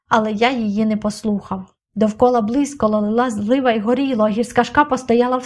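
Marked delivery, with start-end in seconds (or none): none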